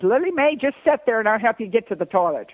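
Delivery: high, loud call down the plane aisle. Retold or imitated. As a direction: shout out cheerfully over the seats. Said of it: "Dolly shouts out from behind her".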